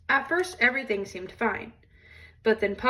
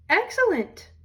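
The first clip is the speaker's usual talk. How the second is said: much higher, prim approval; Mama Bear's delight; 9 semitones higher than usual. Acted. Mama Bear to Papa Bear, approving the ride, high and prim. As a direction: chirp it like a pleased mother bear.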